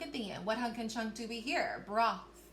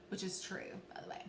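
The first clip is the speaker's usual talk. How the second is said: whispering; 3 semitones lower, 10 dB quieter, faster than usual. Real, not acted. Whispered aside to students.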